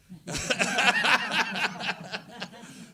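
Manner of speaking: loud high laugh; big laugh. Real, not acted. Laughing at his own story, loud high laugh. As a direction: burst out laughing.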